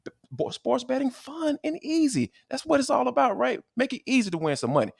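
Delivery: high, pushy sponsor pitch to listeners; podcast host hyping a sponsor deal, energetic ad read; upbeat ad read. Acted. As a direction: sell the betting offer like a radio spot.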